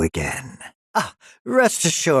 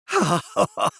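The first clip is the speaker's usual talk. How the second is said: high, gleeful villain titter; greedy giggle; 12 semitones higher than usual. Acted. Scientist within reach of his dream, greedy giggle. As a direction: titter at the thought.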